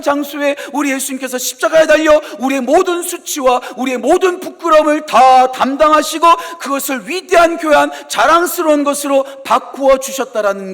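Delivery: loud, high, impassioned preaching; preaching at full force. Acted. Preacher proclaiming grace to the congregation, loud and impassioned. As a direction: build the sermon to a ringing peak.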